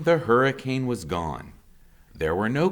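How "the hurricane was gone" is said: ordinary delivery, nothing marked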